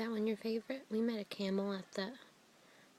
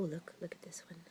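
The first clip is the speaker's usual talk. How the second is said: whisper; 5 semitones lower, 12 dB quieter, faster than usual. Real, not acted. Mother whispering to toddler.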